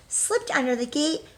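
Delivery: ordinary talk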